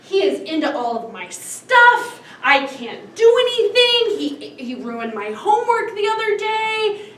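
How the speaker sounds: high, loud acted exasperation; pissed-off little sister. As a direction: imitate a fed-up kid, voice climbing high. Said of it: "She's pissed".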